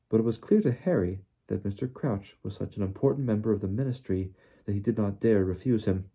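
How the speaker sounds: ordinary delivery, nothing marked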